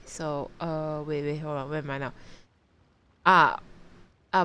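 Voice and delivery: ordinary delivery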